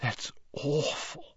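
high, soft, halting dismay; hushed horror. A narrator's reading. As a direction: falter through it, appalled. Slughorn appalled by the account.

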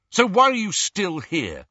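challenging. Acted. Harry challenging Ron.

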